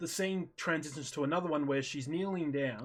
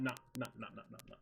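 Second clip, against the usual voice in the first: quiet refusal; 5 semitones lower, 10 dB quieter, much faster than usual. Retold or imitated. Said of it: "I'm like".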